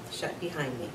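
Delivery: plain reading voice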